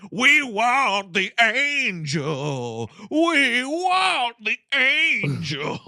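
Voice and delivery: slow, high, drawn-out possessed wail; mock screaming. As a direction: wail it like a possessed man, stretching every word. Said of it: "he just starts screaming".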